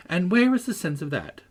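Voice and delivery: high-pitched peevish challenge. A narrator's reading. Catherine challenges Heathcliff over the almanac, peevishly. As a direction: throw the question back, pettish and high. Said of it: "replied Catherine in a peevish tone".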